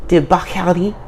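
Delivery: a hammed-up, badly faked French accent on debauchery; mock French accent. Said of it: "that was a really bad French accent".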